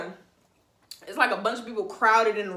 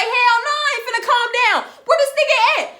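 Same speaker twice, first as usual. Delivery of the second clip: loud, high defiant yelling; enraged mama; 12 semitones higher, 10 dB louder than usual. Retold or imitated. Mama refusing the manager's plea to calm down, enraged. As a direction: imitate a mother shouting down anyone soothing her.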